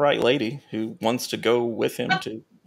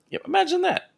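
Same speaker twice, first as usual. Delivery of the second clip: much higher, mock-surprised sarcasm; sarcastic. Real, not acted. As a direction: toss it off with dry irony. Sarcastic aside about no takers.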